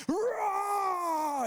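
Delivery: high loud cry of a roar; kid's growl. Retold or imitated. Five-year-old Josh charging the ball with a loud roar. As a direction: let out a little bear rawr. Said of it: "Josh growled the entire game".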